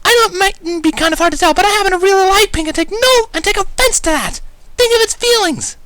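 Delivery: high, loud, indignant scolding; loud berating. A narrator's reading. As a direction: scold him for insulting a color. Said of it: "Pinkie berated loudly".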